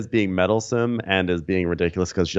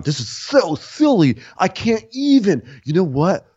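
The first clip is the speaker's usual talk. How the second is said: high, drawn-out mock outrage; hammed-up exasperated rant; 10 semitones higher, 3 dB louder, slower than usual. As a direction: imitate someone utterly fed up. Acted fed-up outburst.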